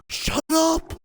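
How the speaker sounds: sharp, high "Shut up" at the politicians; angry shout. Real, not acted. Ranting vlogger snaps at politicians, angry. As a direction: tell them off hard.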